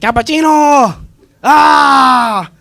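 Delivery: slow, high, loud drawn-out yell; drunk yell. Retold or imitated. Drunk Jose screaming at the bull, loud slow yell. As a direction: scream the bull's name like a madman. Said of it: "Goes fucking crazy".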